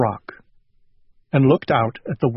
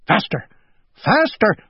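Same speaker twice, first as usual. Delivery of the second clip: urging cries that climb higher; crying out; 12 semitones higher than usual. A narrator's reading. Owl urges himself to run, climbing cries. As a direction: build each word to a high cry. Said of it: "cried Owl".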